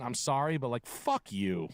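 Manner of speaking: ordinary talk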